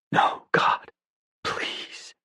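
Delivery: broken whispered plea. Acted. Dawson sees her body, whispering a plea. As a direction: breathe it out in terror. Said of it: "his words were a whisper".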